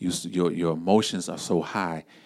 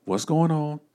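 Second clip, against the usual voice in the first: higher, soothing hold-on voice; pitched-up gentle calm down; calming a panicked caller; 9 semitones higher than usual. Acted. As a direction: settle a frantic family member down. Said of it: "family member call you panicking and freaking out, say".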